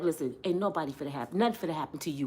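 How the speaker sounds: low, very soft, fast murmur; low, soft, quick conspiratorial murmur. Pretend. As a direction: lean in and sweet-talk him quietly. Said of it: "they be like".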